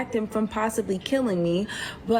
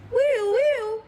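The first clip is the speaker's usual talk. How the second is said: high whooping siren sound, louder; imitated siren whoop; 14 semitones higher, 4 dB louder than usual. Teller mimics siren heard from the car. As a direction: mimic a police siren wailing. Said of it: "all I hear is".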